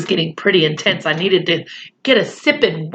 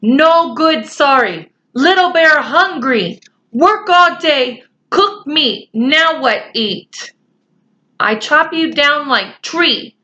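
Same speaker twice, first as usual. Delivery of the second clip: slow, higher, stern Indian chief voice in broken English; Little Bear scolding; 7 semitones higher, slower than usual. Acted. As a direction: scold the boys like a proud little warrior.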